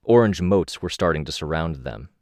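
plain reading voice

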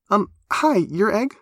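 high, eager, breathless greeting; nervous girl, bursting with excitement; excited. A narrator's reading. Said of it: "out of breath from sheer excitement".

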